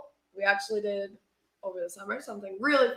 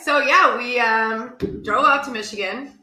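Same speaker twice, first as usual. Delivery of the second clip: loud; 4 semitones higher, 12 dB louder than usual. Real, not acted. Loud restart of story.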